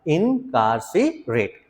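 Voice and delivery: इनकार्सिरेट stretched very slowly; drawn out. Shown as a demonstration. Teacher drills the word very slowly for class. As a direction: drag out इनकार्सिरेट, each syllable long. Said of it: "तेज बोलना एक बार".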